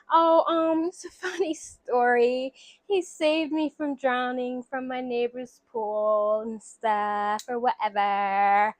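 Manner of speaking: slow, drawn-out imitation of a smitten girl; mock coy storytelling. Girl telling therapist how they met, slow mock-coy drawl. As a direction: stretch the words out, playing her coy.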